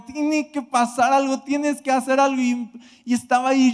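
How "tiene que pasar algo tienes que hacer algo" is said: pitched-up, insistent retold plea; retelling his frustrated demand to God, raised in pitch. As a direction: press God to act, half-angry. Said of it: "Estaba enojado, frustrado".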